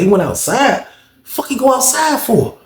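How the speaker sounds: high, incredulous echo question; retold disbelief. Storyteller hearing Tay went outside, retold disbelief. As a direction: question the news high, dragging out the second part. Retelling his reaction to news.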